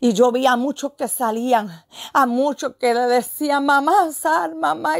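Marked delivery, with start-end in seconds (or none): 3.6-5.0 higher-pitched mock cry for mother; imitating cries. People in the vision calling mamá to come out, imitated high cries. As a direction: act out people calling out, without real shouting. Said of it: "Y yo vi a muchos gritos".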